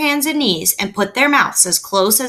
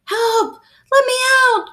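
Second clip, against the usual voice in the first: high, desperate cry for help; a small trapped voice crying out; frightened cry; 4 dB louder than usual. A narrator's reading. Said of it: "cried Stuart".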